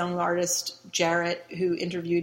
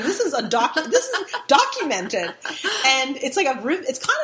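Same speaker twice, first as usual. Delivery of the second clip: louder, laughing through it; amused, laughing emphasis; laughing disbelief; 6 semitones higher, 4 dB louder than usual. Real, not acted. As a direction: laugh that this was really filmed. Laughing at absurd story.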